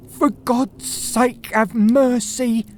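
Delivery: a thief at the detective's feet, shrieking. A narrator's reading. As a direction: shriek for mercy on your knees. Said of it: "he shrieked".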